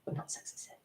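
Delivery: usual speaking voice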